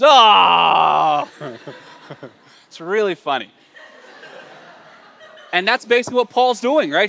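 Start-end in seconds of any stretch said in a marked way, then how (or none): 0.0-1.3 long, loud mock scream; dog's anguished scream. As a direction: cry out as a betrayed dog. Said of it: "then the dog goes".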